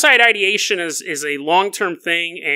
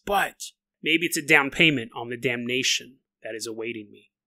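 usual speaking voice

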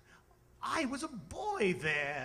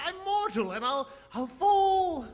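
panicked; 8 semitones higher, 5 dB louder than usual. Acted. Scrooge panics at the window.

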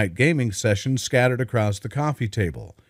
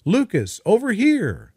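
high calling across the distance; 9 semitones higher than usual. A narrator's reading. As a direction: call from the edge of the woods. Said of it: "a voice rang out from the woods".

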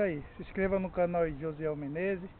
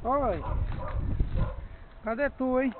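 calling out; 8 semitones higher, 4 dB louder than usual. Real, not acted. Calling out to someone unseen.

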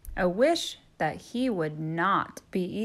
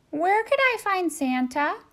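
polite turkey voice; 11 semitones higher, 3 dB louder than usual. Acted. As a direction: ask the way shyly.

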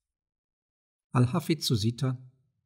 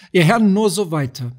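louder call to the lords; 4 semitones higher, 6 dB louder than usual. Acted. Saladin repeats, calling out louder.

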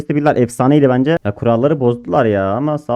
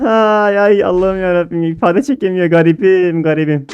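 ordinary talk, nothing marked

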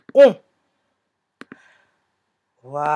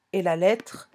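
ordinary talk

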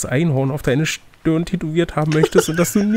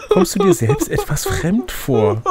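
stretched high; 11 semitones higher, slower than usual. Acted.

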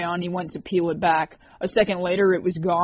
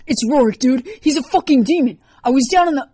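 frantic, high, loud alarm; screaming; 8 semitones higher, 10 dB louder than usual. A narrator's reading. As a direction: yell it like nobody believes you. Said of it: "went right back to screaming".

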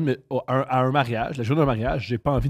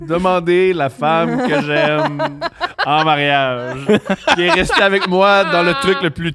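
mock solemn speech; 4 semitones higher, 6 dB louder, much slower than usual.

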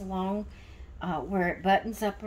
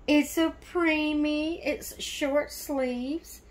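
cooing delight; 5 semitones higher, slower than usual. Real, not acted. Gift opener showing a preemie onesie, cooing delight.

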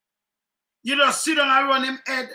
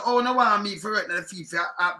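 ordinary talk

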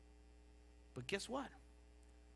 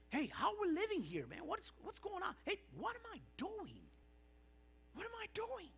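high, soft self-questioning; hushed, high-pitched wake-up moment; sudden realization; 10 semitones higher, 7 dB quieter than usual. Acted. Preacher voices someone coming to their senses, high soft disbelief.

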